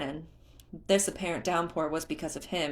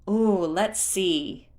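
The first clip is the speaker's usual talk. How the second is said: slow musing ooh; 3 semitones higher, 6 dB louder, much slower than usual. A narrator's reading. Beetle thinking aloud.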